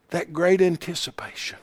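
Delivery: sudden whispered drop; hushed. Real, not acted. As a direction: pull them in with a whisper. Preacher drops to a hush.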